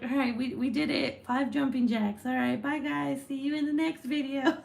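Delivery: mock exhausted sign-off. Pretend fitness host wrapping up after five jumping jacks, mock exhausted. Imagined spent host signing off.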